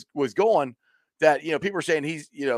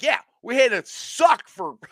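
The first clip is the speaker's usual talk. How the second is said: slow, higher, laughing yell; laughing mock shout; 9 semitones higher, 4 dB louder than usual. Fan retelling his retort to critics, laughing half-shout. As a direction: laugh through a mock rant. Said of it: "I'm like".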